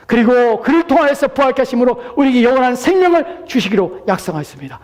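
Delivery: high, loud exhortation; full-force preaching. Acted. Preacher urging listeners to come to Christ, full-force preaching. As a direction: proclaim it urgently at the top of the voice.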